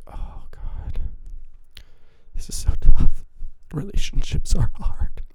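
breathy, overwrought mock lament; mock melodrama. Joking about girlfriend not liking Star Wars, mock despair. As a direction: sigh it out like a tragic soap heroine. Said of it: "I'm like".

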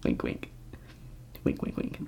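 soft conspiratorial whisper; whispered wink wink. Pretend. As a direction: whisper it like a sly hint. Joking aside about the brothers.

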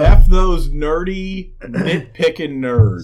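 slow, loud, drawn-out echo; loud mock imitation. Host echoes guest's jab at fans, slow and loud. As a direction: hammer every word of it out. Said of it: "repeats Bill Burr's line".

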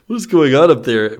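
anxious loud call; echoing question. Acted. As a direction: call out the worried question loudly. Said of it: "Buzz echoed Slink's question".

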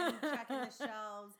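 laughing through the words, slowly. Real, not acted. Friend joking about bossing Home Depot staff, laughing. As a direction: giggle while bragging about checking shelves.

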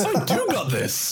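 high giddy surprise; amazed. Retold or imitated. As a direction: marvel at your own power. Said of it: "look at him going".

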